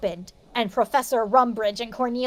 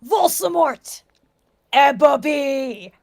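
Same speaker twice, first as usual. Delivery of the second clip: slow, a touch louder villain call; lightly voiced Darth Vader calling Ebony; mock evil villain; 4 dB louder, slower than usual. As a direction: drag out the names like a mock villain. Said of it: "said evilly in his raspy voice".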